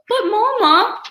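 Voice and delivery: very high, loud begging whine; pleading cubs. Acted. Cubs beg Mama to undo the TV ban, very high whine. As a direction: beg like a desperate little cub.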